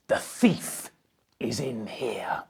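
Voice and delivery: unvoiced, hushed warning; secret whisper. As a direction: whisper it like a detective sharing a clue. Dog's bark meaning, secretive.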